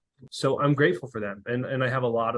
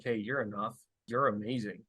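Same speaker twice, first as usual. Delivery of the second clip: much softer, tender reassurance; tender affirmation; 2 semitones lower, 6 dB quieter than usual. Retold or imitated. Dad telling his kids they are enough, soft and tender. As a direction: say it like a quiet promise.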